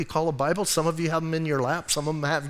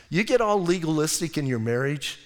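ordinary delivery

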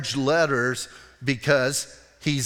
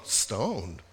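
stoner townsfolk; 7 semitones lower, 5 dB quieter than usual. Acted. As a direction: drop way down like a pleased stoner. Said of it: "'Cause Bisbee people go".

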